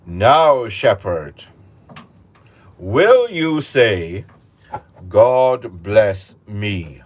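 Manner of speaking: loud, slow, menacing royal voice; stern king. Acted. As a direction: draw each word out as a threatening king. King demands shepherd says it.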